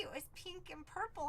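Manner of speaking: ordinary talk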